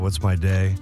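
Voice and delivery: ordinary talk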